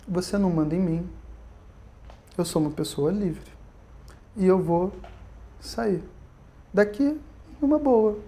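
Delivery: quiet, unbothered reply; calmly. Retold or imitated. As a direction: say it soft and untroubled, as if nothing happened.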